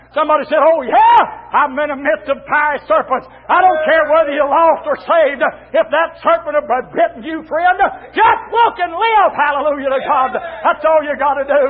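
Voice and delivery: impassioned pulpit exhortation; full-force preaching. Real, not acted. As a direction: shout it out to the congregation.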